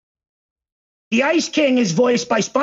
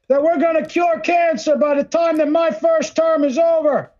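mock politician speech; 6 semitones higher than usual.

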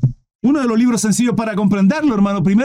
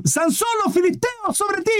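high-pitched mock yell, held back in volume; grito imitado; 10 semitones higher, 5 dB quieter than usual. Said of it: "algo así le grita". (grito fingido, agudo y alargado)

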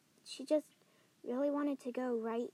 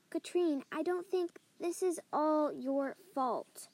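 ordinary talk, nothing marked

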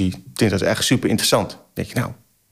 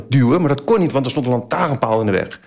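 ordinary talk

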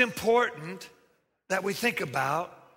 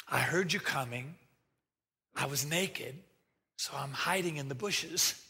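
softer, lower, sheepish confession; sheepish Adam; 6 semitones lower, 6 dB quieter than usual. Acted. Adam admitting to God he is hiding, softer and lower. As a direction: mumble it like a caught child.

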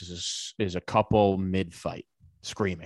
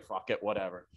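muttered dismissal; 9 dB quieter than usual. Retold or imitated. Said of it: "And she's like".

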